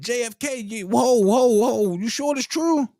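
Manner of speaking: high, rising disbelief; mock-stunned whoa whoa; shocked question. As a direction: ask it like you can't believe it. Asking a friend, shocked.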